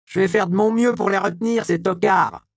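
high, loud defiant cry; defiant shout. Acted. Magdala vows to hold off the sergeants, loud and defiant. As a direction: fling it out boldly.

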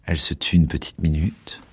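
ordinary delivery

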